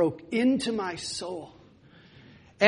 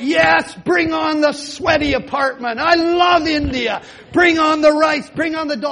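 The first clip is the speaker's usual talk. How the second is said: higher, louder elated cry; joyful shouting; 6 semitones higher, 4 dB louder than usual. Retold or imitated. Welcoming the sweaty apartment and the rice, shouted joyfully. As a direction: shout it out with new joy. Joyful outburst after the breakthrough.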